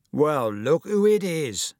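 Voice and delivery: bully greeting his victim with mock delight; mocking drawl. A narrator's reading. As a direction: savour the taunt slowly.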